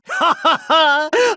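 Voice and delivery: high quick cackle; eager cackle. Acted. Scientist about to experiment on a specimen, high eager cackle. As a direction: cackle in anticipation.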